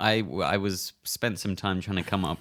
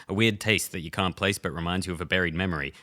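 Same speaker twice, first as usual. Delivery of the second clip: quieter, rattled-off side effects list; mock disclaimer; 3 semitones lower, 3 dB quieter than usual. Ad voiceover reading the side effects, mock disclaimer. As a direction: rush through the small print like an ad disclaimer.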